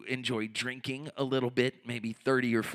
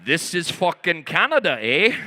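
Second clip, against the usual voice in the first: Canadian bluster; 3 semitones higher, 8 dB louder than usual. Pretend. Riled-up comedian bellowing at crowd in mock Canadian accent. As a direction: yell it like an offended Canadian, eh. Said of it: "I start feeling Canadian".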